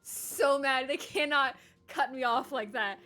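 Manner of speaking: loud, high-pitched real exasperation; worked-up indignant outburst; so mad. Real, not acted. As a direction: protest it loudly, dragging out the outraged words. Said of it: "I'm so mad right now".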